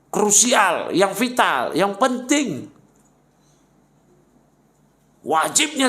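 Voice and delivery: high, punchy preaching with falling phrase ends; emphatic khutbah delivery. Shown as a demonstration. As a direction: drive each theme home from the pulpit. Demonstrating urgent khutbah themes.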